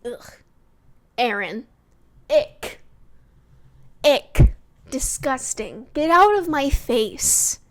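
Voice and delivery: loud, disgusted, slowed on the last line; disgusted yell. Real, not acted. Vlogger telling off Aaron on the show, loud disgust. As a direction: spit the disgust at him.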